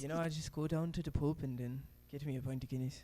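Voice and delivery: casual Irish pub brogue; Irish accent. Shown as a demonstration. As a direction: chat in a put-on Irish accent. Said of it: "talking like an Irishman".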